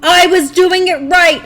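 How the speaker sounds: frustrated outburst. Real, not acted. Gamer to her stream, loud frustrated outburst. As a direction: blurt it out, annoyed at yourself.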